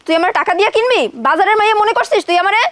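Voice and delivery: loud, high-pitched challenge; indignant fury. Acted. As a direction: throw the offer back at him in outrage. Rejects being bought with money.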